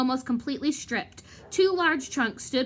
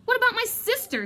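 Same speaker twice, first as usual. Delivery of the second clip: jerky; 12 semitones higher than usual. Acted. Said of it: "said Ron jerkily".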